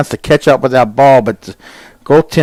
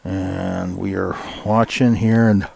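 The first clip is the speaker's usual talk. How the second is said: slow, softer words stretched through a yawn; 4 semitones lower, 6 dB quieter than usual. Real, not acted. Commentator yawning mid-call to listeners, slow and soft. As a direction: talk right through a yawn. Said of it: "I'm sorry for yawning".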